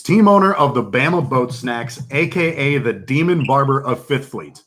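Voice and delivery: normal talking voice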